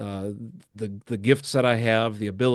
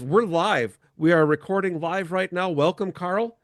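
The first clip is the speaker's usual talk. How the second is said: higher, eager host voice; real excitement, pitched up; excited; 6 semitones higher than usual. Real, not acted. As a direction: lit up as the pastor joins live. Guest unexpectedly joins live show.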